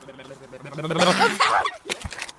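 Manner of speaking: loud real laugh; laughing at silly nonsense; laughter. Real, not acted. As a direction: burst out laughing loudly.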